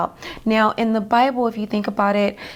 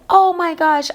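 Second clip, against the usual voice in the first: high, loud cry of alarm; re-enacted panicked outcry; retold panic; 8 semitones higher, 6 dB louder than usual. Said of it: "I was in complete panic".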